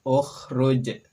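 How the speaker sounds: Ukhruj with a throaty kh and bouncing j; Arabic demo. Shown as a demonstration. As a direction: model Ukhruj loudly for students. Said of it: "Berikutnya silakan".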